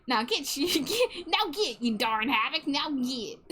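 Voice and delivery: high, laughing Southern scolding. Pretend. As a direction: shoo them off in a mock Southern twang. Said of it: "it's Southern voice".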